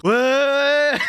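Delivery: put-on loud, high, drawn-out drunken yell; mock a plastered friend answering the phone; imitating drunk Alan. Streamer mimicking his drunk friend on the phone, slurry holler. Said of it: "Alan, ivre".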